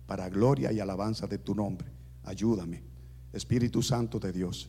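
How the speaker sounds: low, soft, earnest prayer. Real, not acted. Preacher prays for the congregation, soft and earnest. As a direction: pray quietly and sincerely to God.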